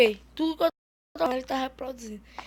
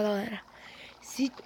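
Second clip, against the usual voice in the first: speaking softly; 4 semitones lower, 11 dB quieter than usual. Real, not acted. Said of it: "eu vou falar baixinho pra ele não escutar".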